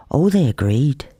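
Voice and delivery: ordinary delivery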